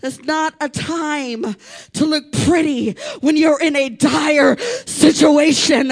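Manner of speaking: ordinary talk, nothing marked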